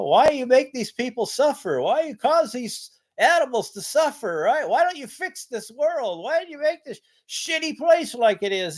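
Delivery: high, pleading rant at the sky; ranting at God. Retold or imitated. Man ranting at God over suffering, high pleading voice. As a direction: rail at God about the world's suffering. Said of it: "I get mad and I start really throwing a really, really terrible rant towards God".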